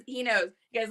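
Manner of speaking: usual speaking voice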